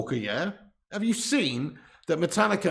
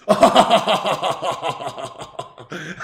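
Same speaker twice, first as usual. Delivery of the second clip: loud mock-evil cackle; long, theatrical Grim Reaper laugh; villainous laugh; 5 dB louder than usual. Grim Reaper ends his threat laughing.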